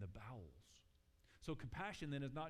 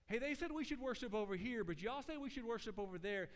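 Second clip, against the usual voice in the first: higher, louder challenging voice; mock argumentative woman; 8 semitones higher, 4 dB louder than usual. Woman at the well challenging Jesus, higher and louder. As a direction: toss a petty argument at him, pitched up. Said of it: "she tries to throw that mess at Jesus".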